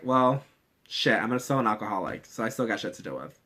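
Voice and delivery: soft, low, resigned grumble. Retold or imitated. The hero admitting she is still an alcoholic, softly resigned. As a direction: shrug it off quietly. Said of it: "she's just like".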